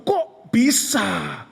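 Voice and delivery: slow, drawn-out Kok bisa cried out; crying out. Retold or imitated. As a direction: stretch Kok bisa as a cry. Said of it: "Itu Roh Kudus berseru". (Kok bisa, diserukan pelan dan panjang)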